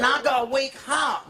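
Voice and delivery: high comic woman's voice; prostitute's warning. Acted. Prostitute warns her customer, high put-on voice. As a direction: plead in a squeaked-up female pitch.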